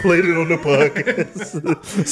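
laughing through the words. Real, not acted. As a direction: say it while cracking up. Repeating the line, laughing.

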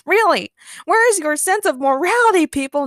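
high, loud, scandalised cry; outraged scream. A narrator's reading. Nur to the indifferent beachgoers, outraged scream. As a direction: exclaim it in loud disbelief. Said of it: "Nur screamed".

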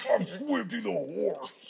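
high, slow, eerie horse voice; creepy horse voice. Retold or imitated. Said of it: "who talks like this".

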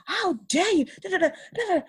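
high, squawky mimicry of outrage; mock ranting manager. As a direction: parody the boss's indignant babble.

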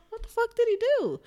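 very high, startled question; stunned viewer, voice jumping way up; shocked. Retold or imitated. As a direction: gasp out the question. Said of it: "you're like".

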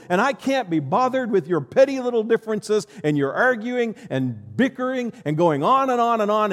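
a king who has run out of patience, yelling; fed-up king. Pretend. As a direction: blast them out of the courtyard. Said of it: "I'm King Solomon. I'm going".

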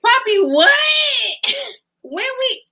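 high, loud shocked squeal into laughter; shocked shriek. Real, not acted. Shocked at raunchy comment.

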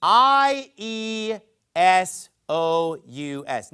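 slow letter-by-letter spelling I E S O U S; spelled out letter by letter. Shown as a demonstration. Preacher spelling the Greek name slowly for the congregation. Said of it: "Everybody got that?".

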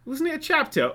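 high, needling put-on voice; teasing companion. Friend teasing the flustered Doctor, high and needling. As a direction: prod her like a nosy friend. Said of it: "when the other one's like".

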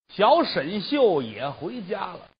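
ordinary delivery

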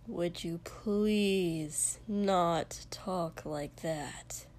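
very slow, pained request fading out; mortified plea. A narrator's reading. Blushing boy begs friend to stop, slow and mortified. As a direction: drag it out, sinking to a whisper. Mortified Shoto, head in hand.

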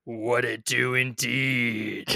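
slow, drawn-out mock tough voice; gravelly dramatic voice. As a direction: copy the gravelly greeting slowly. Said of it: "I wanna try that".

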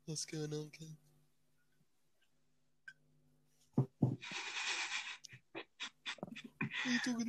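hammed-up stoner teacher. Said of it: "complete stoner".

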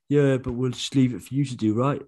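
put-on brothers in a Cockney accent; lazy Cockney brothers. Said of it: "Your Cockney brothers".

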